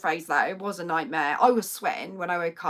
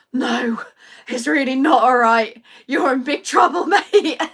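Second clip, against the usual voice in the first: loud, half-joking telling-off; mock scolding; 3 semitones higher, 9 dB louder than usual. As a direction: pretend he is in trouble. Teasing her husband after nightmare.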